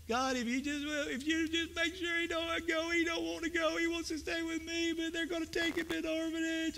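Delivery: put-on high, tearful child's prayer; tearful little boy. Preacher voicing a crying boy praying for his friend, high and tearful.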